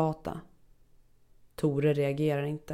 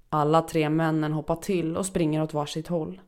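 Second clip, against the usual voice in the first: ordinary delivery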